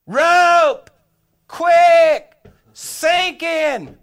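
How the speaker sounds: very loud, high holler, one word at a time; full-voiced shout for help; retold distress yell. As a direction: bellow each word across the water. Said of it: "all of a sudden I yelled".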